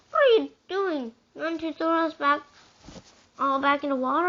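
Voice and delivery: high, loud alarmed protest. Acted. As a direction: object as he tips them overboard. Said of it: "I protested".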